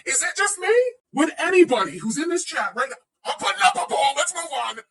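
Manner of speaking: high-pitched, worked-up appeal to the room; exasperated outburst. Real, not acted. Streamer appeals to the chat over bras, exasperated. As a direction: raise the pitch and cut it off in exasperation.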